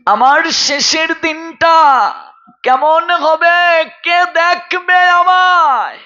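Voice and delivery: high, slow, wailing lament; acted wail climbing to a long-held cry; tearful maidservant. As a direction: plead high and drawn out, imitating a weeping servant. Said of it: "দাসীটি কেঁদে কেঁদে বলছে".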